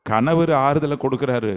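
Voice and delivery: usual speaking voice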